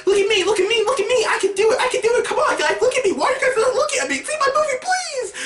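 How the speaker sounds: mock voice of a desperate film begging for attention; attention-seeking movie. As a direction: squeal like a needy show-off. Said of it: "It was so forced".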